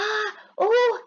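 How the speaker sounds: high mock groans of pain; hammed-up groans. Tom groaning to wake Sid, high mock pain. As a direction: cry out as if in fake pain. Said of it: "ऐसा कर रहा था".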